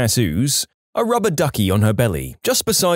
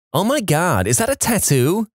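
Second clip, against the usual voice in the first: put-on higher, flat Valley Girl gasp at a tattoo; 6 semitones higher than usual. Snotty church girl to the narrator's friend, Valley Girl voice. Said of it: "in her flat Valley Girl voice".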